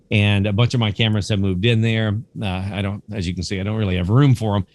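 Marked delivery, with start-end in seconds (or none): none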